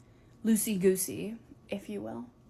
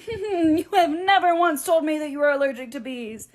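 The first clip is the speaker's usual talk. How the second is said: higher, louder, laughing through it; laughing disbelief; 8 semitones higher, 7 dB louder than usual. Retold or imitated. Friend laughing back at her pal's sudden bee allergy claim.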